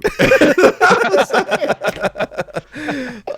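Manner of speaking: high squealing laugh; loud burst of laughter. Real, not acted. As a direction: crack up hard. Busts out laughing.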